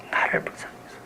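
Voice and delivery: whispered plea for mercy; earnest plea. Acted. Blind beggar begs Jesus for mercy, whispered earnest plea. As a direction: breathe the prayer out in a whisper. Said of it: "온 정성을 다해서".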